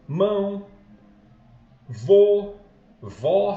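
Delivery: each word called out loud; example words. Shown as a demonstration. Teacher to students calling out example words Mão, vô, vó loudly. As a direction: punch out Mão, vô, vó one by one, loud. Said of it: "por exemplo, pé".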